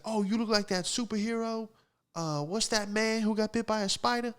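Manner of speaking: high-pitched put-on woman's voice; high mock female voice; lady impression. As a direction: pitch it way up, like a nosy lady searching for a name. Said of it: "this lady goes".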